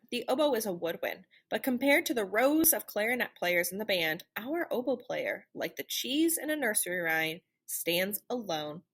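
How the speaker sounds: usual speaking voice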